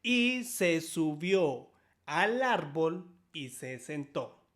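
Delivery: normal talking voice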